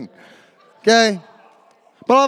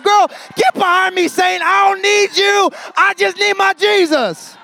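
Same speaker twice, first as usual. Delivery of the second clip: mock rebuke; 11 semitones higher than usual. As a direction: cast out temptation with theatrical fervor. Rebuking temptress.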